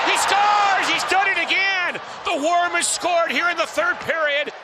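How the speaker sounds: high excited goal call. Real, not acted. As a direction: call it with a lift. Goal call by play-by-play announcer.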